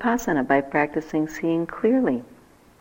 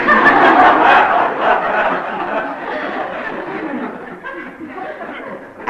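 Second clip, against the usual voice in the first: long, high, breathy real laugh; 10 semitones higher, 4 dB louder than usual. Real, not acted. Teacher laughing at her own story with the students, warm and long.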